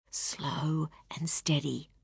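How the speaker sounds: slow, soft whisper to yourself; whispered to herself. Acted. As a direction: breathe the words to steady your feet. Inner thought while stepping carefully.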